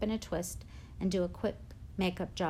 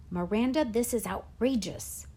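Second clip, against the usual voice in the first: furious; 2 semitones higher than usual. A narrator's reading. Drama teacher to girl backing out, furious. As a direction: voice outrage with some restraint. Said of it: "Davenport looked furious".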